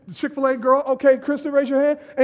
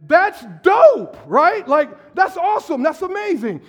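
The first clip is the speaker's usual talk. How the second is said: higher, louder hyped praise; enthusiastic reply; 7 semitones higher, 6 dB louder than usual. Retold or imitated. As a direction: cheer her on with big energy. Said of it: "I said".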